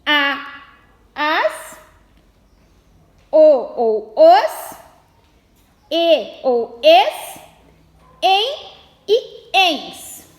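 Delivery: "o ou os", "e ou es" spelled out slowly, each ending apart; slowly listing each ending for pupils; slow dictation of endings. Shown as a demonstration. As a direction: read "o ou os" and "e ou es" slowly like dictation to a class. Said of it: "Acentuam-se as palavras oxítonas terminadas".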